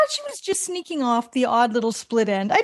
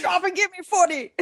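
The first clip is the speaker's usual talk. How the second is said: higher, odd-voiced sergeant bark; drill sergeant; 7 semitones higher than usual. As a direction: order it like a boot-camp sergeant. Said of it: "was she raised by a drill sergeant".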